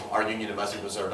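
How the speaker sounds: fast, low, soft repeat; quick aside. Shown as a demonstration. Teacher repeats a quote for students, quick low aside. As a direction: rattle it off quietly as an aside.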